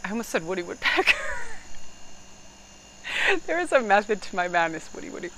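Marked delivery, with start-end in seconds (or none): 1.2-2.1 sudden high-pitched laugh, then softer; high burst of laughter. Real, not acted. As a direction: let out a real high laugh at your own slip. Laughs at own slip.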